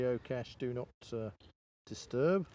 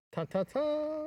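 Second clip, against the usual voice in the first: fanfare; much slower than usual. Real, not acted. Revealing the hidden geocache.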